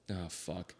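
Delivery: muttered dismay. Retold or imitated. Dad hearing son is beer run guy, low soft curse. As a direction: let it drop out under your breath.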